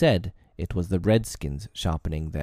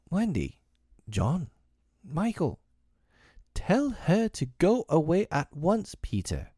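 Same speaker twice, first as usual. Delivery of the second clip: put-on children crying out; 9 semitones higher than usual. A narrator's reading. Three children beg Peter to send Tink away, crying out. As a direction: plead in a high child's voice. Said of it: "the three cried simultaneously".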